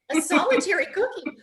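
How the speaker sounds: high, loud, incredulous repeat with a laugh; baffled echo. Retold or imitated. Retold baffled reply to the man.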